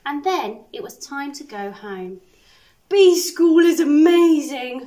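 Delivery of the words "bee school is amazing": louder and slower, full of wonder; delighted. Acted. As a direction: gush with wide-eyed joy. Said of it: "thought Norman".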